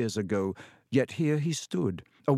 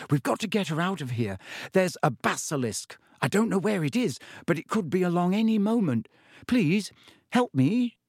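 put-on desperate boy; 6 semitones higher than usual. A narrator's reading. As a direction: urge him to flee, then beg. Harry pleads about the basilisk.

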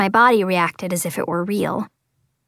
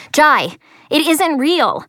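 high urgent shout; 6 semitones higher, 3 dB louder, slower than usual. Acted. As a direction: call out desperately. Jumping between them, shouting.

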